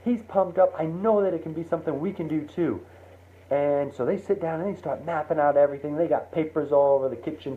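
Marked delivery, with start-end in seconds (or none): 0.0-2.8 high and eager throughout; fired up telling his wife; thrilled. Retold or imitated. John assuring his wife, thrilled.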